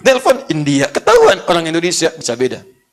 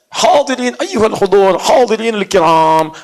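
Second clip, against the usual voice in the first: slow, drawn-out ceremonial address; formal Arabic address; 3 semitones higher, slower than usual. Shown as a demonstration.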